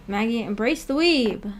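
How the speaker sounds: ordinary talk, nothing marked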